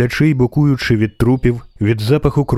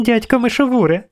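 put-on much higher child's voice; excited little girl; 12 semitones higher than usual. A narrator's reading. Girl greets her uncle after climbing down, high childlike cry. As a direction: call out as a small girl spotting an uncle.

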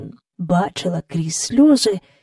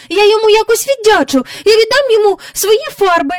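put-on fast, high, loud child's plea; rushed high-pitched pleading; pleading girl; 6 dB louder, faster than usual. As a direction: bargain urgently in a little girl's voice. Jane pleads to be let go.

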